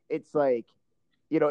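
normal talking voice

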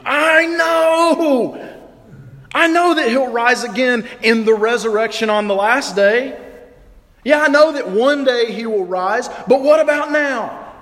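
high, loud, pained outburst; grieving sister's desperate protest; anguished Martha. Acted. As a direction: cry out in grief at Jesus. Martha voiced in grief.

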